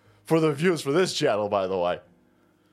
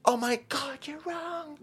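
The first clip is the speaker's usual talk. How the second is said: much higher, wailing, trailing off to nothing; shrill mock whine; 9 semitones higher, 6 dB quieter than usual. Protester protesting again, higher wailing mock whine. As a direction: squeal the protest as it collapses. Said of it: "The guy".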